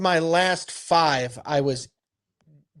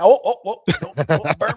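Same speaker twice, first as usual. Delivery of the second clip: high, louder panicked pleading; mock panic; 4 semitones higher, 3 dB louder than usual. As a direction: act out panicking over the bowstring. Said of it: "going like this".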